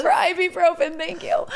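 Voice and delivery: high, giggly mock gratitude; laughing. Real, not acted. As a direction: laugh through it, amused at herself. Laughs at own excitement.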